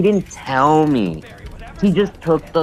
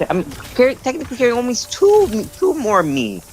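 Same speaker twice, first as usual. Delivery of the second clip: exasperated rant; 11 semitones higher than usual. Real, not acted. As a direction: rant in disbelief. Heated venting about partner.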